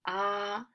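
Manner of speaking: "ah" held on a high, level pitch; first tone. Shown as a demonstration. Demonstrating the first tone for students, a level "ah". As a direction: say "ah" in Mandarin first tone, sustained and even. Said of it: "First tone is".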